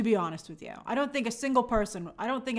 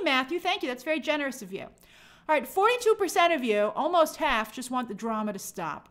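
normal talking voice

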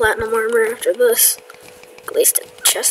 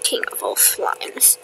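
low, slow dramatic whisper; deep grand whisper; 13 semitones lower, 6 dB quieter, slower than usual. Pretend. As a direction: intone it like an epic title. Mock grand title whispered.

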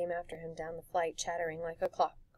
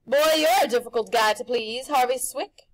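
loud, high showman pitch; pushy grinning tempter; Rictus's brash voice; 5 semitones higher, 13 dB louder than usual. A narrator's reading. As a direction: sell it like a carnival barker. Rictus exasperated.

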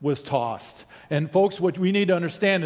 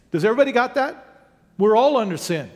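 normal talking voice

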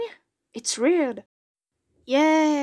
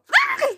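short high burst of character laughter; a sudden squeaky cartoon laugh; acted laugh; 4 dB louder than usual. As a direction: cackle like the villain.

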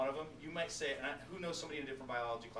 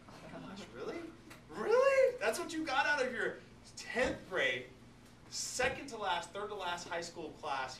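high, loud, incredulous really, then slow; mock disbelief; 5 semitones higher, 4 dB louder, slower than usual. Real, not acted. Teacher scoffing at students with a mock-shocked really. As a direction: pretend outrage at a lazy answer. Teacher mocks a lame answer.

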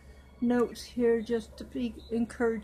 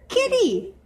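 put-on childlike doll voice; 9 semitones higher, 4 dB louder than usual. Doll answers the name.

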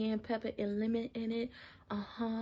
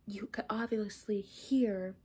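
whispery, failing voice; losing her voice. Real, not acted. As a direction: push words through a voice that's going. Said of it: "I'm losing my voice".

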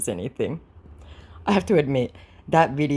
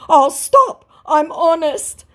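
much higher and louder, slow; squeaky hammed-up plea; mock scammer voice; 14 semitones higher, 8 dB louder, much slower than usual. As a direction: scammer pleading, mocked. Voicing scammer.

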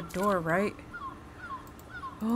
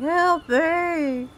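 higher, louder imitated plea for help; mimic's cry; 8 semitones higher, 6 dB louder than usual. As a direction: mimic the monster's fake cry. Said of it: "the mimic dweller that's going".

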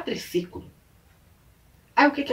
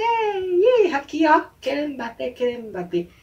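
high, louder mock yelp; egging on a fight; 7 semitones higher, 3 dB louder than usual. Retelling boys starting a fight, high mock taunting. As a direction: heckle a schoolyard scuffle.

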